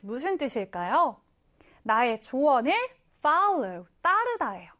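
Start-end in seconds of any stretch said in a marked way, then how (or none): none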